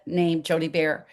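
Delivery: ordinary talk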